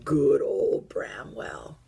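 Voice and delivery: put-on slow old teddy voice; Old Bear voice. A narrator's reading.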